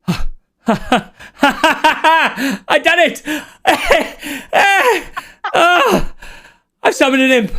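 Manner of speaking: high, gleeful cackle of a nervous wizard; cackling young wizard. Acted. DM voicing the wizard gloating over his summoned imp, high and cackling. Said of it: "scared looking wizard in front of you going".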